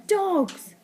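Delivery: high, eager acted answer; sudden answer. A narrator's reading. Boy answers what cats hate, mildly excited. Ned answers Slime's riddle.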